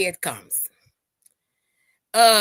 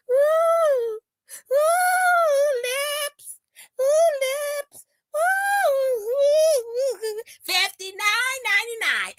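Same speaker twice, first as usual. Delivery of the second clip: very high hammed-up fake wailing; fake crying; 13 semitones higher, much slower than usual. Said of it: "she will be fake crying".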